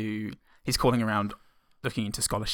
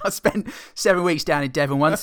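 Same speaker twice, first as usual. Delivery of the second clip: put-on West Country accent; 5 semitones higher, 7 dB louder than usual. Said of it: "West Country accent".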